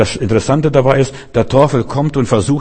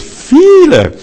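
Viele cried out much higher and louder in shock; stunned outcry on Viele; shocked exclamation; 7 dB louder than usual. Retold or imitated. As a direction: gasp out Viele as if you can't believe it. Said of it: "Ich war entsetzt".

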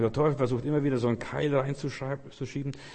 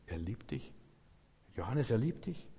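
much softer, gentle and close; tender reassurance; 9 dB quieter than usual. Real, not acted. Preacher softly assures Johannes that God loves him. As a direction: speak it softly to one person. Assuring a listener of God's love.

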